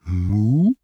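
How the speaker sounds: put-on drawn-out, rising childlike query; innocent echo. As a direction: echo it as if baffled. Hermes innocently echoes the moo.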